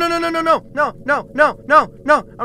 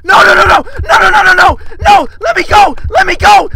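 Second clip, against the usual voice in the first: loud, frantic repeated cries; panicked yelling; 6 dB louder, faster than usual. Real, not acted. Player begging to be let go, panicked yelling. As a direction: yell it desperately, over and over.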